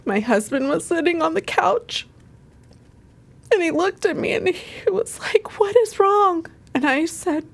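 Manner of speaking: high, choked crying voice; real tearful voice. Real, not acted. Woman recalling telling husband her mom died, real crying. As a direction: struggle to get the words out through tears. Breaks down telling of mother's death.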